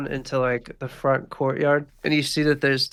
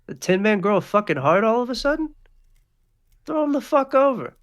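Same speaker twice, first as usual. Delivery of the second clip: mocking order; 9 semitones higher, 3 dB louder than usual. Pee-wee mocking then ordering.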